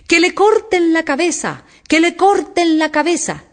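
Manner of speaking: high-pitched royal outcry; furious shouting. A narrator's reading. Enraged Queen orders Alicia's execution, shouting furiously. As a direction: cry out the order in a rage. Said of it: "comenzó a gritar".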